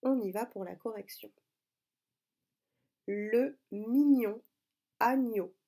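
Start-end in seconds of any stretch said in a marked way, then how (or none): 3.1-5.5 slow, raised, carefully separated words; slow dictation. Shown as a demonstration. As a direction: read it out slowly for correction. Rereading dictation for the correction. (relecture lente de dictée, bien articulée)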